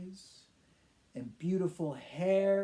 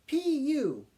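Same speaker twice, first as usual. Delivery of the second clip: disgusted crowd; 9 semitones higher, 4 dB louder than usual. Acted. Zoo crowd reacts to smell.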